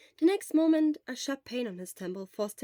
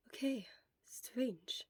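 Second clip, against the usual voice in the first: weak whisper; barely a breath, worn out; whispered from a sickbed; 2 semitones higher, 5 dB quieter, much slower than usual. Acted. Sick Shura whispers throughout.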